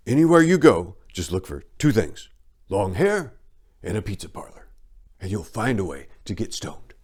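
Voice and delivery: a knowing, voiced-up tip to a kid; telling a young traveller where to score, with a knowing air; streetwise advice. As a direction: lean in and pass on the secret. Advising kid on the plane.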